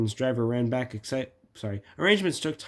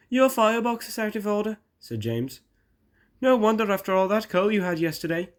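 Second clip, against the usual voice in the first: high, loud engine character; teasing James the engine; 10 semitones higher, 4 dB louder than usual. Acted. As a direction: pitch it well up and needle Gordon.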